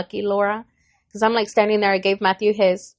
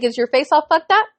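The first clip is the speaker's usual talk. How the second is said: high-pitched rhetorical challenge; retold indignant questioning, rising at the end; sarcastic mom; 7 semitones higher, 3 dB louder than usual. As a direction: challenge his excuse, voice climbing.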